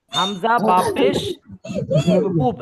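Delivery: slow, drawn-out letter names; slow spelling-out. Shown as a demonstration. Teacher models hamza-ba-pesh to class, slow recitation. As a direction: recite it slowly for learners to follow.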